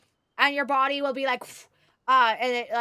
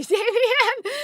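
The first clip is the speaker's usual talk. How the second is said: laughing; 10 semitones higher, 9 dB louder than usual. Real, not acted. Laughing at chat message.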